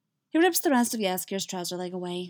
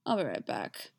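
low reassuring; 7 semitones lower, 5 dB quieter than usual. Acted. Geralt reassuring wounded friend.

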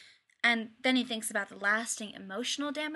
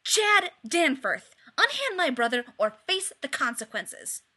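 shouting; 3 semitones higher, 7 dB louder than usual. A narrator's reading. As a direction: threaten him, bursting in. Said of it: "and she shouts".

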